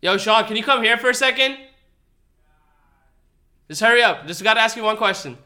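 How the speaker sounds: loud call to the next room. Real, not acted. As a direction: shout across the house. Calling Sean from other room.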